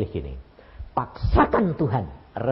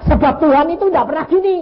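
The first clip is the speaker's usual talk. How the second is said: much higher and louder burst; excited exclamation; 9 dB louder than usual. Real, not acted. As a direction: exclaim it to the hall. Preacher exclaiming to congregation. (seruan bersemangat kepada jemaat)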